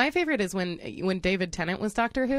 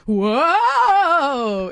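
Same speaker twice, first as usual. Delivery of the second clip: higher, louder exaggerated whoa; big whoa; 7 semitones higher, 7 dB louder than usual. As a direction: overreact to the bump. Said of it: "It's just every time".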